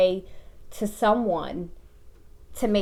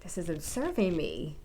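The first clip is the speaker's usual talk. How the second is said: soft self-talk; 5 semitones lower, 11 dB quieter than usual. Retold or imitated.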